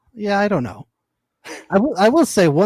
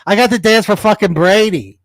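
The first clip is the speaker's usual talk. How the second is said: loud, higher defiant outburst; defiant shout; 6 semitones higher, 7 dB louder than usual. Pretend. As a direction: yell it like a fed-up cheerleader. Voicing the cheerleader defiantly.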